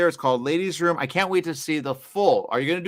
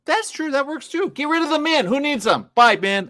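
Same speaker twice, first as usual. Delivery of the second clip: high-pitched, playful cheering-on; mock-gleeful agreement; 12 semitones higher, 4 dB louder than usual. Host agrees women should write everything, mock-gleeful. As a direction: jokingly give in, pitched way up. Joking agreement to drop men.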